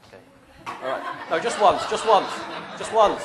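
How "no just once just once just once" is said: loud laughing call, rising high. Real, not acted. Speaker shouting at the blindfolded kids to spin only once, a loud laughing call. Stopping kids spinning too much.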